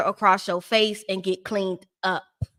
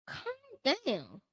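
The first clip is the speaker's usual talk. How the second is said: mock calm down; 13 dB quieter, much slower than usual. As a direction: talk him down with mock sweetness. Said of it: "And I'm like".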